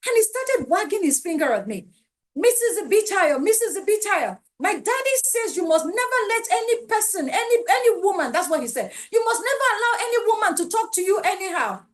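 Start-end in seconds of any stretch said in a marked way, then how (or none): 2.4-8.3 put-on higher, insistent little boy lecturing an adult; imitating a small boy. Boy wagging finger, scolding. 9.1-11.8 put-on higher, insistent little boy lecturing an adult; imitating a small boy. Boy quoting his daddy.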